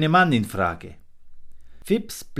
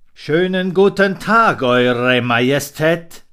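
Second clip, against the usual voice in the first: loud, slow, oily predator greeting; marten's greeting; 5 semitones higher, 10 dB louder than usual. A narrator's reading. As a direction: fawn over the king slyly. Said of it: "grüßte das Raubtier".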